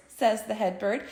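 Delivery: ordinary delivery, nothing marked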